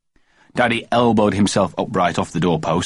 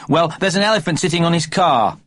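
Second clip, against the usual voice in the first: put-on high child voice; little boy voice; 9 semitones higher than usual. A narrator's reading. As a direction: speak like an excited small boy. Child insists.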